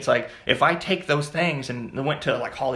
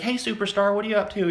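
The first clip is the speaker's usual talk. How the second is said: lady greeting; 8 semitones higher than usual. Retold or imitated. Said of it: "a lady was like".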